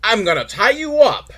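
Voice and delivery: a hint of the crocodile hunter's bedroom roleplay; mock Steve Irwin. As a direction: stretch out the crikey like the crocodile hunter. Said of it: "Is like".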